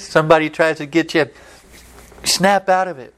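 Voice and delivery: usual speaking voice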